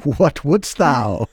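slow mock Shakespearean voice; a light theatrical Elizabethan line; hammy stage actor. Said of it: "speaking in that Bardo speak already".

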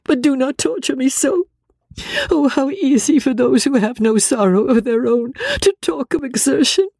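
very high, tormented wailing voice; distraught Marianne. Acted.

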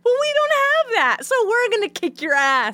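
high-pitched mocking threat; high mocking staff voice. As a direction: squeak out the threat.

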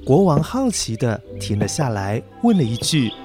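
plain reading voice

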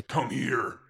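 restrained angry shout from a creature; held-back angry command. A narrator's reading. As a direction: order them over, mock shouting without full volume. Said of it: "became very angry and began shouting at me".

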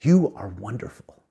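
a slow, soft "You are wonderful"; husband's gentle last words to his wife; simple tender. Retold or imitated. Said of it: "he simply said".

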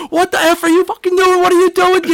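high, squealing mock panic; panicked mascot. Mascot yelling at the dad punching him, high panicked squeal. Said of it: "the Phillie Phanatic is going".

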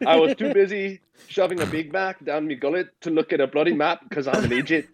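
a cheeky Scottish apology letter voice; playful put-on Scottish accent. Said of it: "Did you do it in a Scottish accent this time?".

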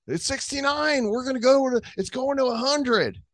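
mock hype. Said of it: "we were like".

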